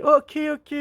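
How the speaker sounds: high-pitched mock backing down; hammed-up submissive parent; cowed parent. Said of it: "Puis les parents qui".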